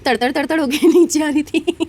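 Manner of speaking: real laughter breaking into the line; cracking up while describing the slide; laughing. Real, not acted. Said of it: "मुझे इतनी हंसी आई".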